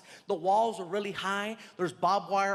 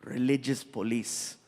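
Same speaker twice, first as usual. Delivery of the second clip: gracious prince; 8 semitones lower than usual. Retold or imitated. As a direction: imitate a courteous royal giving thanks. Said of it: "he was so nice".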